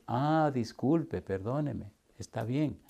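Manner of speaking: very soft, low apologetic voice; hushed, placating retold apology; meek apology. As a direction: imitate a man backing down softly. Retold man meekly apologizing to complainer.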